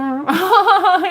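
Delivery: a big, high, delighted laugh; loud burst of laughter. Real, not acted. Remembering the night they made up, a loud laugh.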